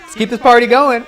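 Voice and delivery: high, loud party whoop; hyped-up cheer. Real, not acted. Host echoing the panel to keep the party going, hyped cheer. Hyping the panel along with clip.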